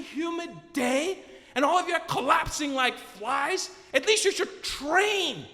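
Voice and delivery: high, loud, slow scolding; imitated woman lecturing the runners; drawn-out, exasperated telling-off; scolding nurse. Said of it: "she's trying not to laugh".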